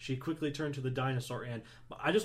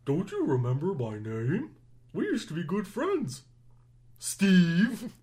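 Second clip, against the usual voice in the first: dinosaur voice; 6 semitones higher, 9 dB louder than usual. Acted. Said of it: "I am terrible at this".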